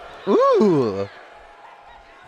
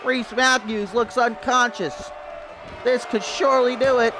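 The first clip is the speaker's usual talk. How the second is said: play-by-play commentary; 13 semitones higher than usual. Acted. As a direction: call the match like a ringside announcer.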